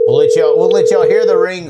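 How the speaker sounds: loud, upbeat announcing to the audience; loud announcement. Real, not acted. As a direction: call it out loudly to listeners. Announcing the call to listeners.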